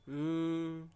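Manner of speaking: a steady closed-mouth hmm held out; demo hum. Shown as a demonstration. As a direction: hum it to show the sound. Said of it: "जिसकी उच्चारण करने पर दोस्तों". (बंद मुँह से गुनगुनाहट का प्रदर्शन)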